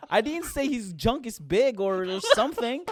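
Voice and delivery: worked-up, comic indignation; exasperated defence. Real, not acted. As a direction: draw out each thing you never said. Mock listing what he never said.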